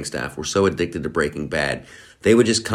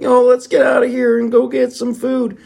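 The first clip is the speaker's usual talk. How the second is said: hungry cartoon slacker voice; Shaggy voice; 5 dB louder than usual. Explaining Shaggy as the stoner, high loud cartoon voice. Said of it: "He's like".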